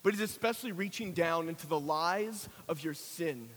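low, soft invitation with long pauses; hushed preaching. Real, not acted. Preacher inviting listeners to reconciliation, hushed preaching. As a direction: bring the sermon down to a quiet, intimate appeal.

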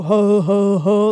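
low jolly ho ho ho; Santa laugh. Pretend. Preacher opens with a deep Santa laugh. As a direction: belly laugh like Santa Claus.

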